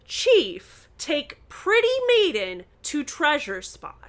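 hammed-up pompous command without the accent; stentorian chief. A narrator's reading. Ned in costume announcing to Nancy, mock stentorian. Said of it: "said in a stentorian voice".

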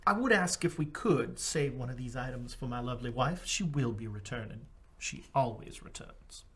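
low, soft in-character voice; quietly certain of his lovely wife's return; devoted husband character. Acted. Player speaks as his character.

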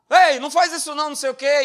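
loud, high scolding grandpa. Retold or imitated. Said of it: "do vovô lá".

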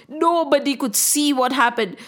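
ordinary delivery, nothing marked

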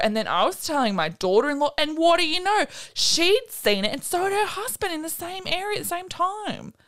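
high-pitched mock lady telling her story; hammed-up high voice for the lady; imitated lady witness. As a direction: play an amazed witness, pitched well up. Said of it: "And then one lady was like".

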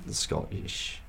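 usual speaking voice

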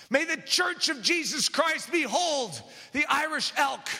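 ordinary talk, nothing marked

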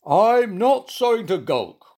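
high, slow, loud self-pity; sad. A narrator's reading. Sulking bear answers monkey, sad high moan. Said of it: "muttered Goompa in a sad voice".

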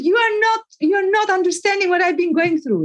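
high-pitched retold protest; acted indignant complaint; aggrieved client. Client objects in retold quarrel.

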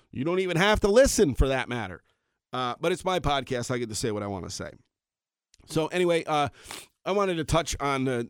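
ordinary talk, nothing marked

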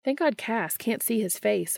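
plain reading voice

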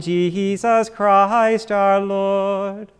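ordinary talk

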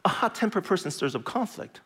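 usual speaking voice

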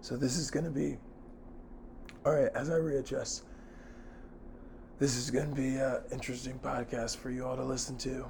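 lost voice, straining to speak; hoarse and worn out, barely any voice. Real, not acted. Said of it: "I have no voice".

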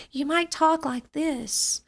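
small, hesitant voice; timid voice. Shown as a demonstration. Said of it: "If you speak in a timid voice".

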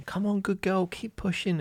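imitating midwives urging the mother on, high and encouraging; encouraging midwife. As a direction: coax her on like a kind midwife.

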